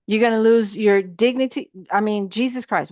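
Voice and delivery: ordinary delivery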